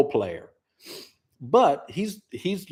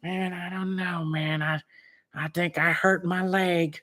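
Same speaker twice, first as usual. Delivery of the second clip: Greg impression; 6 semitones higher, 3 dB quieter than usual. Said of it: "That's the way he sounds, man".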